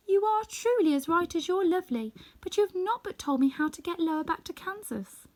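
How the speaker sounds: put-on high, girlish Dorothy, gently pleading; a young girl, admiring yet reminding.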